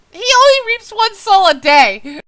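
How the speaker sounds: high, loud self-correcting outburst; exasperated yell. Real, not acted. Reader fed up with misreading one word, exasperated yell. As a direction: shout the correction in frustration. Exasperated at own reading mistake.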